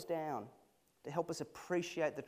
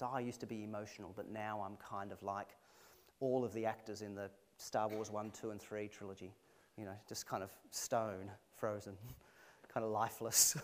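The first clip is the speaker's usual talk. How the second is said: mock unemotional; 6 semitones lower, 6 dB quieter than usual. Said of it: "It's not spiritual and mature".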